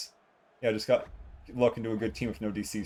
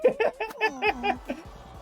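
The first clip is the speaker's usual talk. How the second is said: high laugh; 3 dB louder than usual. Real, not acted. Laughing at own hubris.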